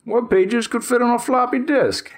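put-on high, cranky old geezer voice; curmudgeonly old man. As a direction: grumble like a grumpy old-timer. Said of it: "I sound like a curmudgeonly old man".

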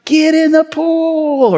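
high, slow, excited shouted call; gleeful toddler scream. Retold or imitated. Son calling his sister into the pool, a high excited shout. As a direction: yell it like a thrilled two-year-old daring his sister. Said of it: "he screamed out".

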